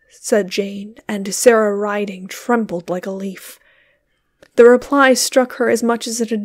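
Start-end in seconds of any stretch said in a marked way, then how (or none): none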